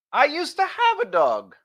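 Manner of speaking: high, drawn-out self-centred reply; upping reply. Shown as a demonstration. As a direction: steal the moment with your own dog. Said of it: "that is upping".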